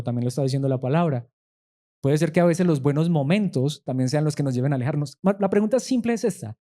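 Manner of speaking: ordinary talk, nothing marked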